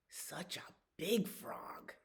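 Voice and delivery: whispered frog chorus. Acted. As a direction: hiss it like teasing frogs. Frogs mock dragon.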